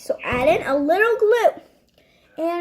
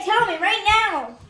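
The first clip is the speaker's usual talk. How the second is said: ordinary talk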